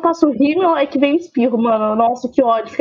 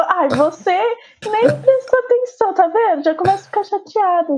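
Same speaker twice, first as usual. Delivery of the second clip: mock offended; 6 semitones higher than usual. Playful complaint.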